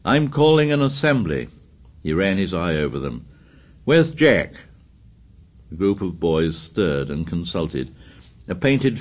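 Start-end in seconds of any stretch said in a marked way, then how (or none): 0.0-1.5 louder, higher call; loudly. A narrator's reading. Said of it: "Ralph spoke again, loudly". 3.8-4.5 sharp demanding call. A narrator's reading. Ralph demanding of savages.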